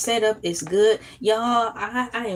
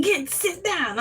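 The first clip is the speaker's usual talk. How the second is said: high-pitched Southern rooster-like bluster; Southern teacher impression; 7 semitones higher than usual. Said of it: "He sound like Foghorn Leghorn".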